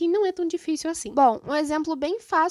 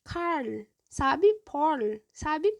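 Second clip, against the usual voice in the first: parl and carl with a Minas Gerais curled r; mineiro accent; much slower than usual. Shown as a demonstration. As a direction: say it like someone from Minas. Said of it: "Que nem mineiro".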